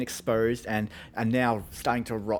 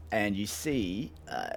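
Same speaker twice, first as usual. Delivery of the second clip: ordinary talk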